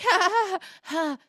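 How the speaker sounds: high, forced ha-ha; mock nervous laugh. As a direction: force out a panicky fake laugh. Retold nervous fake laugh.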